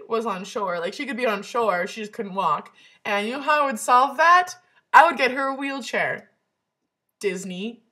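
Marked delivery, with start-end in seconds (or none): 3.0-6.3 excited loud. Real, not acted. Proud fix for Ariel.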